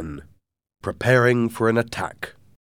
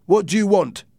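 stern challenge; 12 semitones higher, 8 dB louder than usual. A narrator's reading. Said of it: "the captain asked".

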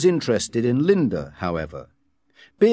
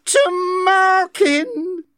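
high, very slow and trembling; 4 dB louder, much slower than usual. A narrator's reading. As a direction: quaver his name with hurt. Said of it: "she repeated in a trembling voice".

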